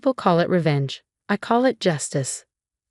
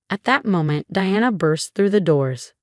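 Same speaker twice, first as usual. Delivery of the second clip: ordinary delivery, nothing marked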